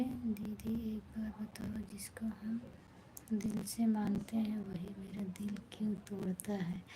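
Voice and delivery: soft, unvoiced-sounding whisper of a comment; whispered reading. Real, not acted. Streamer reading a viewer's question while others sleep, whispered.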